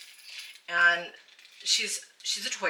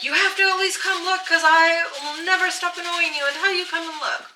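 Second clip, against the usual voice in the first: pleading; 10 semitones higher, 6 dB louder than usual. Retold or imitated. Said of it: "I'm like".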